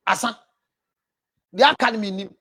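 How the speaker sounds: ordinary talk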